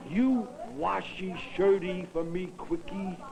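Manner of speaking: slow, high mock pidgin English. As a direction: pidgin English, drawn out teasingly. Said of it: "knock off that pidgin English".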